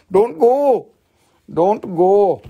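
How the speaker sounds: high, loud hammed-up begging; mock pleading. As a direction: beg them to stay, playfully overdone. Mock begging viewers to stay.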